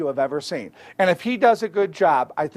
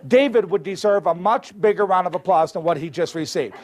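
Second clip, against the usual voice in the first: usual speaking voice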